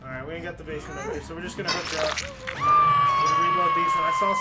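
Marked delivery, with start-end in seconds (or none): 2.5-4.3 clown voice. Pretend. Playing the clown killer.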